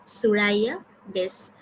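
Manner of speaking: normal talking voice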